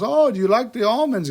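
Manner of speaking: put-on high, sweet old lady voice, pitched well up. A cleaner's story, the old woman offers almonds in a high old lady voice. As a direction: chirp it like a frail grandma. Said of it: "she goes".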